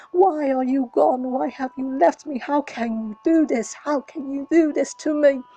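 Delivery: loud, desperate pleading; anguished shout. A narrator's reading. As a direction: shout it out in grief. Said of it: "He screams, calling to his father".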